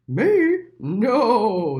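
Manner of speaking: loud, booming denial; a big horse scoffing. A narrator's reading. The horse denying it to Little Mole, loud and booming.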